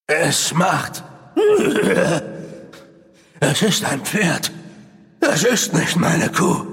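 slow, hollow ghostly voice; eerie apparition voice. Acted. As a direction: drag it out like a lost spirit. Apparition speaks again.

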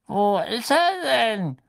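put-on high, slow, thin old man; puzzled old official, high and loud. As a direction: ask it in a thin, drawn-out elderly pipe. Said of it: "sagte die dünne Stimme des Alten".

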